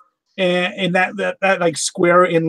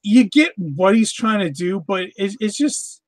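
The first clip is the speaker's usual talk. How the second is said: ordinary delivery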